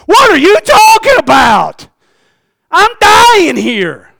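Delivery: high, loud, pleading disbelief; sick man yelling back at friends about clothes; exasperated sick man. Acted. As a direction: cry out slowly in disbelief. Paralytic protests.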